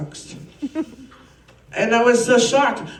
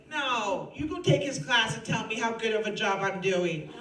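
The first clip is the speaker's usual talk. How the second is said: normal talking voice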